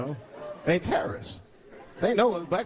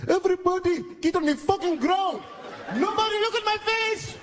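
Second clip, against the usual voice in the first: put-on high, shouted hijacker accent; 13 semitones higher than usual. Gunman yelling at the passengers, high and frantic. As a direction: bark it like a panicky hijacker. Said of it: "He said".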